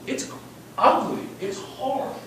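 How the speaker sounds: ordinary talk, nothing marked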